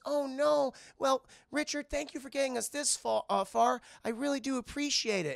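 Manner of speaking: high, polite reindeer voice; Olive. Retold or imitated. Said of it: "She goes".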